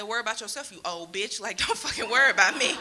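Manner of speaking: loud, furious telling-off; angry rant. Retold or imitated. Comic imagining cursing out her nosy aunt, loud and angry. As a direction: let her have it, full blast. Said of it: "deep in my heart, I said".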